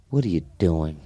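low, imitated puzzled disapproval; retold deadpan question. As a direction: ask it low, as the onlooker. Chris Rock's retold disapproving question.

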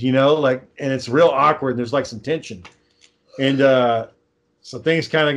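normal talking voice

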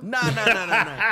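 loud laughing no; laughing denial. Real, not acted. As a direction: laugh it off loudly. Laughing denial, much louder.

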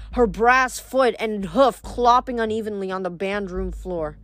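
ordinary delivery, nothing marked

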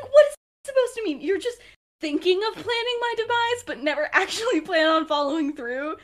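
high, loud retold challenge; mock indignant. Regulus challenging Sirius over planned betrayal, mock indignant. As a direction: act out indignant disbelief, pushing the pitch up.

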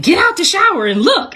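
high-pitched imitation of an urgent call; urgent mock yell. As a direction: act out an excited summons, pitched well up. Retelling relatives' urgent call to look.

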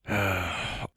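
long soft tired sigh; weary sigh. Acted. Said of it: "The man let out a sigh".

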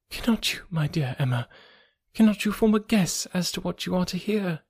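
hushed and shaky; trembling voice. Acted. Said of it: "in a trembling voice".

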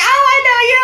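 very high, loud excited recognition; Fran Drescher. Retold or imitated. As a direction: squeal it like a hammed-up celebrity. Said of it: "do her voice as well".

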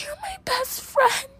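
put-on high, slow wailing drunk cry; hammed-up tearful drunk wail; mock sobbing. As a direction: whimper it like a messy drunk friend. Drunk Cassie crying to Maddie.

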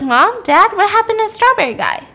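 high, quick call to parents; alarmed questioning. Retold or imitated. Hannah calls out to parents.

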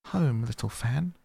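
high, wondering question; surprised question. Acted. Young Scrooge to his sister Fan, amazed and lifted high. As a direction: ask it as if you can't believe it. Boy astonished at the news.

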